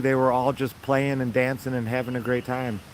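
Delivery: ordinary delivery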